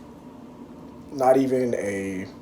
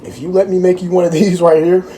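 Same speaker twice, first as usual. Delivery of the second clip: excited; 6 semitones higher, 8 dB louder than usual. Real, not acted. Hyping food to viewers.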